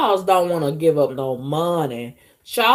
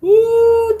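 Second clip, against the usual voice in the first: high laughing shriek; laughing squeal of disgust; 7 dB louder than usual. Real, not acted. Disgusted reaction to the offer.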